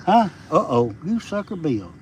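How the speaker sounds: usual speaking voice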